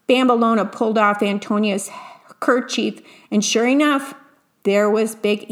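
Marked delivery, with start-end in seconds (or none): none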